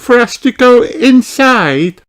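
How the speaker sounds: high, loud eager asking; excited little princess voice; excited question. A narrator's reading. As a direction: ask bursting with excitement. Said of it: "asks Princess Holly excitedly".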